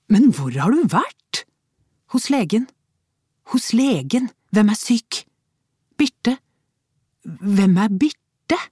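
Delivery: high, loud sharp back and forth; husband interrogating wife, heated; heated questioning. A narrator's reading. Heated exchange between spouses.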